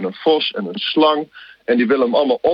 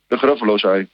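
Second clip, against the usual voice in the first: normal talking voice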